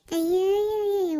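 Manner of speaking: ಅಯ್ಯಯ್ಯಯ್ಯ stretched very slowly and pitched high; sarcastic long wail of disbelief; scoffing at the neighbour's claim; drawn-out mock dismay.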